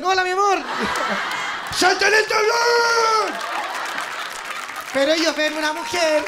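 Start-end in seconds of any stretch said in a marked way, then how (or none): 0.0-0.6 higher, louder mock flirt; flirting guy. Guys at the disco hitting on her, cheesy higher flirt. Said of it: "los huevones te dicen". 0.6-4.9 big shrieking laugh. Real, not acted. Audience roars.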